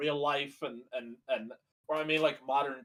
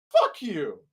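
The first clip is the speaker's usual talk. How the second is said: laughing curse; 4 semitones higher, 5 dB louder than usual. Real, not acted.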